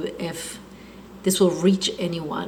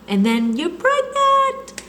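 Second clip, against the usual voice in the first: excited; 3 dB louder, slower than usual. Retold or imitated. Joyful news of pregnancy.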